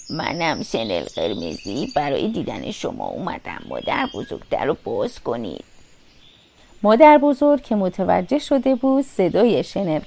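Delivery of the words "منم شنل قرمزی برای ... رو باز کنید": ordinary delivery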